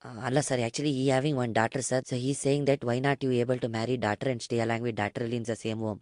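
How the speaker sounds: low, soft, quick deferential patter; meek broker. Acted. Broker passes on owner's odd proposal, meek low murmur. Prank caller as broker Nagesh.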